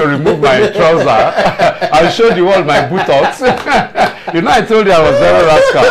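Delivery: words bubbling with real laughter; laughing while talking. Real, not acted. Lawyer recalling his father's canings to the host, laughing.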